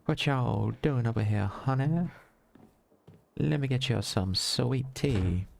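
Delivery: sugary Southern belle hostess; playful Southern accent, offering hospitality. Pretend. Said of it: "I certainly can't do those accents, but I adore them".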